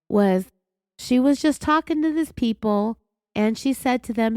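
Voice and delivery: usual speaking voice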